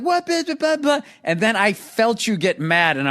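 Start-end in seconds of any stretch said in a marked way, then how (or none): 0.0-1.0 high-pitched mimicry of a taunter; mock her blustering in a high squawk; hammed-up high taunting babble; mocking imitation.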